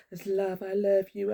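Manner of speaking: imitating her own overly loving message; mock gushing. Telling the lads her gushing message, mock sweet. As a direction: gush it like a tipsy hippie. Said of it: "that's how I was coming across".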